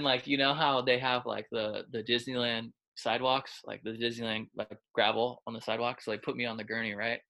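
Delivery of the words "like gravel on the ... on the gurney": ordinary talk, nothing marked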